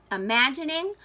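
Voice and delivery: ordinary delivery, nothing marked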